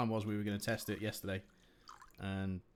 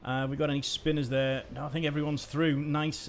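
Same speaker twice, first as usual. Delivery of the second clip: energetic play-by-play, louder; live race commentary; 6 semitones higher, 7 dB louder than usual. Acted. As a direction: call the race as it unfolds.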